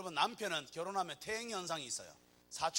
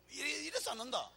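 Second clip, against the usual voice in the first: ordinary talk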